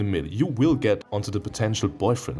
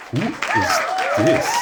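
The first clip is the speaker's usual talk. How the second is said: very high, slow astonished question; surprised; 5 dB louder, much slower than usual. Real, not acted. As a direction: stretch it out in shock. New character appears on screen.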